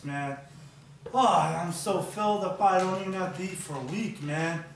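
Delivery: loud, booming, greedy eater bragging over his food; a loud, hungry character gloating over a feast; loud glutton voice. Acted.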